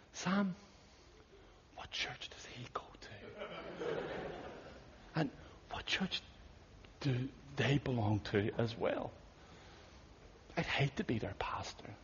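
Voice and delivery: soft, scandalised whisper; pained whisper. Retold or imitated. Churchgoer whispering to pastor Sam about the wild guest, pained. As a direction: murmur it behind a hand, wincing. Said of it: "said with a very painful expression".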